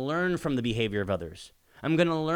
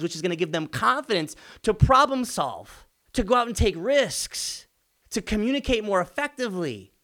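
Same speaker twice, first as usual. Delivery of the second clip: fired-up motivational push; 8 semitones higher than usual. Acted. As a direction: drive it home like a coach rallying the room. Passionate pep talk to parents.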